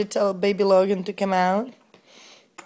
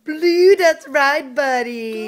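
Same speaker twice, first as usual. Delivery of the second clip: loud, high cheer for a right answer; excited praise; 6 semitones higher, 7 dB louder, much slower than usual. Real, not acted. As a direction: celebrate it like he just won.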